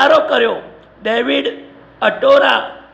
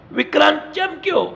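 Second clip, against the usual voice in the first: ordinary delivery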